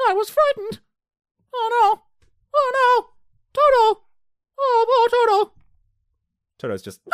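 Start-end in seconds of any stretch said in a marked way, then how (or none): 0.1-5.5 hammed-up fright in piercing falsetto; loud, very high mock wail; Dorothy impression. Dorothy frightened, crying for Toto, mock falsetto. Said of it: "That's exactly how she sounds the whole movie".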